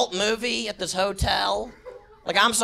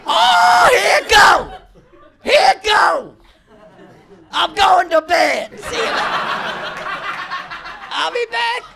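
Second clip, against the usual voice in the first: pitched up high and loud, giddy as the pill hits; high giddy drift; 8 semitones higher, 5 dB louder than usual. Pretend. Clubgoer on the dance floor as Ambien hits, high giddy squeal. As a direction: squeal it out as the sleeping pill kicks in.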